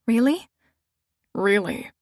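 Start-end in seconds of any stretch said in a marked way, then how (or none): 0.1-0.5 surprised question. Acted. Surprised question answered by echo.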